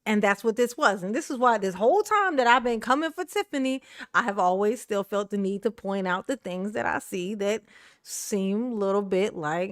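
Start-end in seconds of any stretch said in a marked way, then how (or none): none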